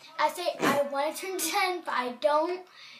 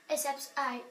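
ordinary talk, nothing marked

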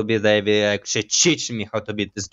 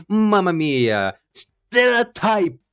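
mock Italian; 7 semitones higher, much slower than usual. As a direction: ham up an Italian cliché. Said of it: "maybe he is Italian".

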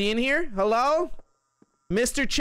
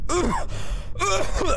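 dying groan; 10 semitones higher than usual. Acted. Character dizzy and dying in game.

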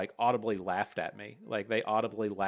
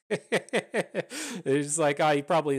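laughing; 6 semitones higher, 6 dB louder, slower than usual. Real, not acted. Laughing about his own voice.

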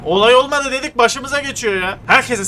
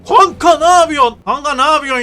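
loud outburst; 7 semitones higher, 7 dB louder than usual. Real, not acted. Shouting at a driver.

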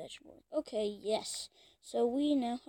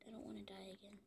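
whisper; 4 semitones lower, 24 dB quieter, faster than usual. Real, not acted. Whispered to self.

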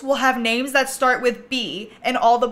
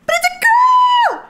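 excited shriek; 8 dB louder, much faster than usual. Real, not acted. Baby born.

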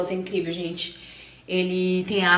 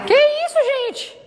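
surprised; 6 dB louder than usual. Real, not acted. Surprised at the baby.

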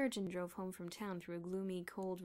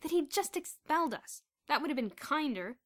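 bitter and loud; savage; 7 semitones higher, 10 dB louder than usual. A narrator's reading. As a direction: spit it out bitterly. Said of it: "said Bruno savagely".

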